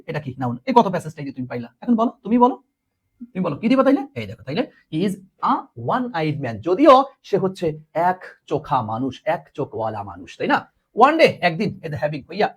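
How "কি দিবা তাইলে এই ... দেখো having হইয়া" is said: ordinary talk, nothing marked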